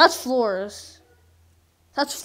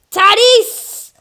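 loud, much higher yell of "Tatis!"; shouted name; 8 semitones higher, 13 dB louder than usual. Real, not acted. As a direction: bellow the name "Tatis!" at the screen. Name yelled louder.

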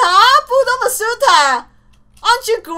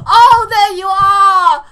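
normal talking voice

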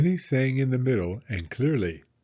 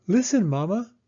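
put-on high, eager child voice; a small girl begging her mother to listen; little girl Penny; 10 semitones higher, 3 dB louder than usual. Little Penny asks Mama to listen, high and eager. Penny's voice in read-aloud story.

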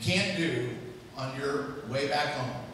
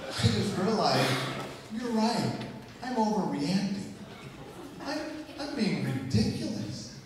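higher-pitched mock concession; mock-contrite wife; 5 semitones higher than usual. Imagined wife conceding to husband, mock-contrite. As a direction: imitate a wife meekly admitting fault.

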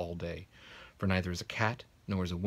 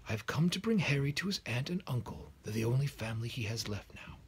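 a hint of the old headmaster, pitched higher; lightly higher old wizard; 7 semitones higher than usual. A narrator's reading. Dumbledore telling McGonagall why he came, lightly voiced. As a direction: state it simply and kindly. Dumbledore explains his plan.

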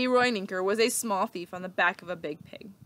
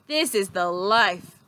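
contented sigh; 6 dB louder, slower than usual. A narrator's reading. Leroy relaxing with candy.

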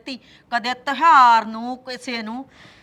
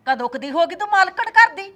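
higher, louder sneer; scornful taunt; 6 semitones higher, 8 dB louder than usual. Acted. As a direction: taunt her with contempt. Mother-in-law mocking daughter-in-law. (ਸੱਸ ਦਾ ਉੱਚੀ ਤਾਅਨਾ)

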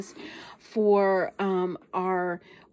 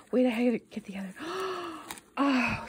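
ordinary delivery

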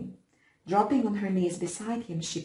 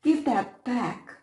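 sobbing plea; 7 semitones higher, 3 dB louder, slower than usual. A narrator's reading. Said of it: "sobbed the younger".

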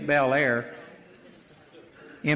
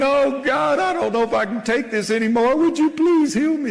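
whiny pleading prayer; 7 semitones higher than usual. Pretend. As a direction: act out a helpless, pleading prayer. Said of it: "That's not resisting the devil".

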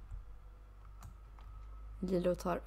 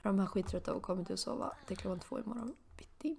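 usual speaking voice

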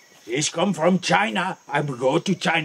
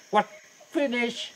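ordinary talk

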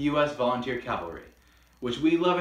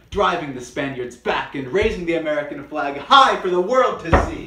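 building louder and higher to the end; getting excited; 4 semitones higher, 8 dB louder than usual. Real, not acted. Host retelling the charge, building real excitement. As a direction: swell with rising excitement. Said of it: "God, I get excited just thinking about it".

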